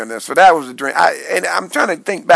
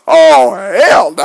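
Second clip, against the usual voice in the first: drawn-out oh hell no; 4 semitones higher, 5 dB louder, much slower than usual. Retold or imitated. Panicked at the men haters.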